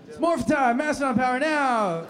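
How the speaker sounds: high, projected hero battle cry; morph call. Acted. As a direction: call out the transformation like an action hero. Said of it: "when we did our morph sequence".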